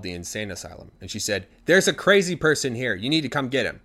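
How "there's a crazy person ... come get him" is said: louder, higher, insistent demand; urgent report. Acted. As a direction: demand they come fetch him. Wife phones asylum to report husband.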